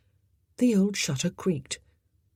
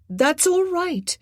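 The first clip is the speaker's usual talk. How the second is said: calling back; 13 semitones higher, 5 dB louder, slower than usual. A narrator's reading. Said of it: "Jean called back".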